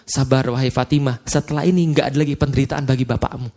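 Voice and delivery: low, soft, tender reassurance; calm a grieving daughter softly; gentle comfort. Acted. Prophet comforting [name]. (Rasul menenangkan [name], lembut dan pelan)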